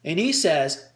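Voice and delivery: normal talking voice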